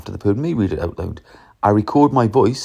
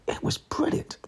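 soft, whispered rave; hushed admiration; 10 dB quieter than usual. Real, not acted. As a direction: drop to a near-whisper in admiration. Awed praise of an audiobook reading.

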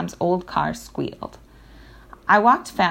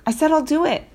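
irritated, higher and louder; annoyed retort; 9 semitones higher, 4 dB louder than usual. A narrator's reading. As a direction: snap back at mom. Boy snaps back at mom.

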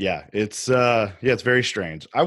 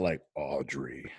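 much lower, much softer and slow, reaching for a bass; deep Audrey voice; 8 semitones lower, 11 dB quieter, slower than usual. Pretend. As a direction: drop as low as you can on "Audrey", slow and soft. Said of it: "I can't get deep".

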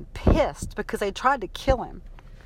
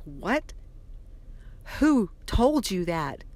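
stunned disbelief; slower than usual. Retold or imitated. Shocked reply to mother's revelation.

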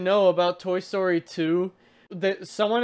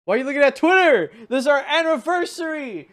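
indignant outburst; 8 semitones higher, 6 dB louder, faster than usual. Real, not acted. Outraged at partner on anniversary.

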